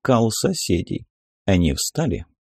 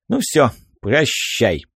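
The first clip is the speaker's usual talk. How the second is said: plain reading voice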